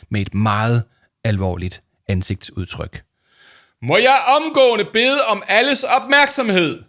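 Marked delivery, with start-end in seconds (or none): 3.8-6.8 high, loud, slow booming call for attention; loud, slowed-down alarmed announcement; Paul shouting. Acted. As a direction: bellow it across the hall, slow and high. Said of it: "råbte han".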